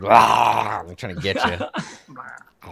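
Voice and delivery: slow, groaning undead head; zombie voice. Acted. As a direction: drag it out like a hungry corpse. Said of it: "the desiccated heads is just like".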